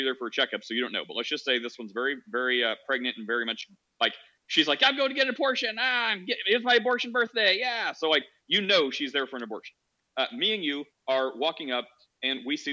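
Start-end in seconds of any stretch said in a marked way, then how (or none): 4.8-7.9 high-pitched, hammed-up excitement; mock gleeful woman. Speaker mimicking a woman celebrating her abortion birthday, mock glee. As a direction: play an absurdly thrilled patient, voice pitched way up.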